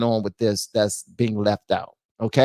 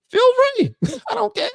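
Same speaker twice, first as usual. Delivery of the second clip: squeaky high, laughing; laughing dare. Real, not acted. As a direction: toss it out in falsetto through laughter. Defiant host cracking himself up.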